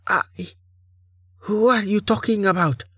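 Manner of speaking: high, timid, frightened voice; small high-pitched nervous question. A narrator's reading. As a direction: ask it timidly, afraid to offend. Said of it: "Anya said in a small voice".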